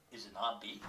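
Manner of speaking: ordinary delivery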